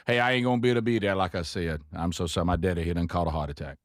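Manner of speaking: low, quick Cajun country talk; Cajun accent. Retold or imitated. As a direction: sound like a Louisiana Cajun. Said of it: "as Cajun a phrase".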